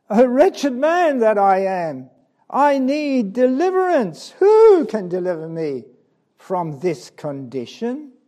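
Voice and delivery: loud, high plea for deliverance; anguished cry. Acted. Preacher voicing the sinner's plea, loud and high. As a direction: cry out for rescue.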